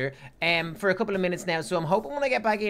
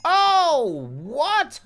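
high, loud, astonished cry; amazed outburst; 10 semitones higher, 7 dB louder than usual. Real, not acted. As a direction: burst out in disbelief at a huge reveal. Sees the massive school expansion.

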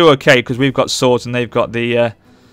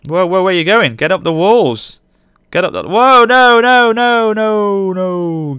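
rising panicked shout of whoa and no; alarmed yelling; 8 semitones higher, 6 dB louder, slower than usual. Real, not acted. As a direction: yell at fleeing troops, climbing higher and louder. Troops retreat from the walls.

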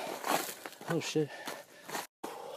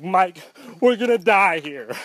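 higher and louder, mock panic; a hammed-up frightened cry from the girlfriend; scared girlfriend; 4 semitones higher, 4 dB louder than usual. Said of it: "Now she like".

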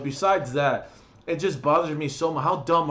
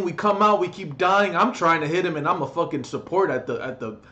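usual speaking voice

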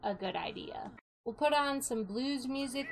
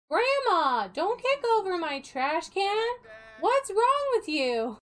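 mock scolding; 6 semitones higher, 4 dB louder than usual. Scolding grandma in game.

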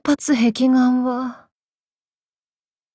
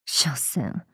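whisper; 9 semitones lower, 11 dB quieter than usual. Acted. Muttered dismissal.

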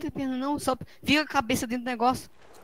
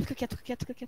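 whispering while hiding; 8 dB quieter, faster than usual. Real, not acted. Hiding in car, enemy approaching.

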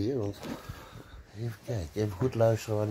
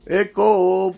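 calling out; 7 semitones higher, 9 dB louder than usual. Real, not acted. Calling out while playing hide and seek.

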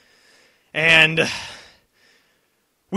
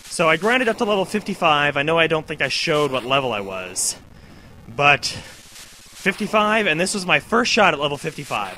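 normal talking voice

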